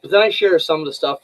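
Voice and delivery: normal talking voice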